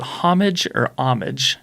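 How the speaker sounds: "homage" as HOM-ij, then "homage" as OM-ij; two pronunciations contrasted. Shown as a demonstration. Host asks listeners to choose, "homage" with H versus without H. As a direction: sound the H in the first "homage", drop it in the second.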